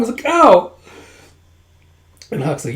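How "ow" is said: sharp high loud ow; pained yelp. Acted. Second dragon bumps his head and yelps.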